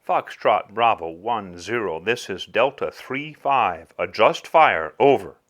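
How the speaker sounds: lightly voiced military radio call; steady, clipped-by-procedure radio voice; calm radio fire call. Acted radio call for artillery.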